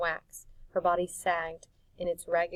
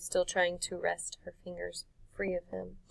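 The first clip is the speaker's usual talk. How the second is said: plain reading voice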